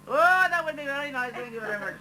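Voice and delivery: high, sing-song Indian accent, hammed up; mock Indian accent. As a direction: play the Indian girl, sweetly and politely, in an Indian accent. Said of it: "beautiful Indian girl".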